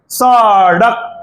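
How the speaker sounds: सड़क said very slowly, leaning on the ड़; slow teaching demo of सड़क; drawn-out sadak. Shown as a demonstration. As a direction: stretch सड़क out to show its flapped ड़ sound. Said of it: "अड़क की ध्वनि निकल रही".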